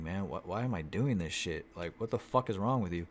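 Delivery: usual speaking voice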